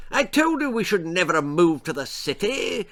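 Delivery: high-pitched, indignant lord; peevish aristocratic grumbling; snappish. A narrator's reading. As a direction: complain crossly, pitch swooping up. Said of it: "he snapped at his wife".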